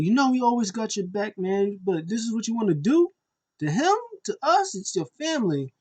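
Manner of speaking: high-pitched imitated pleading; emotional appeal. Brother appealing to family loyalty, imitated pleading. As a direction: plead with him, pitch jumping up. Acting tearful appeal to a relative.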